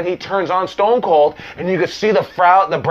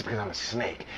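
much lower and much softer, a cold hiss-free menace; menacing snake; 8 semitones lower, 16 dB quieter than usual. Retold or imitated. Retelling CM Punk's snake story, low and menacing. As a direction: drop it low and quiet like a coiled snake. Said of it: "And he says".

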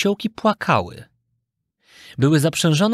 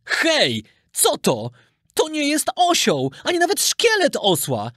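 startled cry climbing much higher; horrified merchant; 11 semitones higher than usual. A narrator's reading. Merchant finding no donkey in the sea, horrified. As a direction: exclaim in shock, pitch jumping up. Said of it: "zawołał przerażony kupiec".

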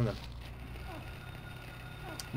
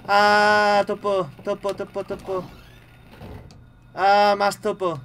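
long high scream into frightened chatter; shriek, then babble high in alarm; real high-pitched panic; panicked cry; 6 semitones higher, 6 dB louder, much slower than usual. Real, not acted. Startled again by game enemies.

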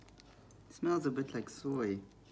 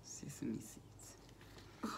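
slow, breathy whisper; 13 dB quieter, much slower than usual. Real, not acted. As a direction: whisper it slowly. Whispered aside.

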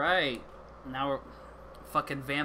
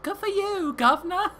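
mock Cockney, higher and louder; mock British; 14 semitones higher, 5 dB louder than usual. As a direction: cheer him on in a hammed-up London accent. Answering the British game character.

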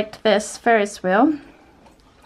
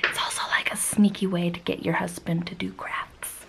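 conspiratorial whisper, much softer; 5 semitones lower, 11 dB quieter than usual. Real, not acted. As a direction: whisper it like a secret. Sneaky confiding aside to camera.

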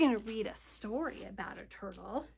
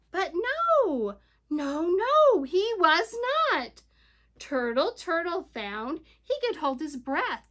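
ordinary talk

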